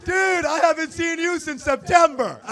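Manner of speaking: high, loud, drawn-out greeting across a distance; hollering friend. Retold or imitated. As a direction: imitate an oblivious friend calling out.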